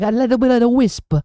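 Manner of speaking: playful fake Italian-American voice; American Italian accent. Shown as a demonstration. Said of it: "that's an American Italian accent".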